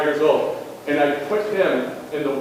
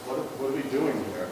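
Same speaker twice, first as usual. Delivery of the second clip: quiet disbelief; 9 dB quieter than usual. Retold or imitated. Coach's dismay at crying player.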